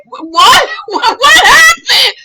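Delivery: loud high confused shout with laughter; baffled husband. Retold or imitated. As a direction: play him clueless and startled. Husband's confused reply acted.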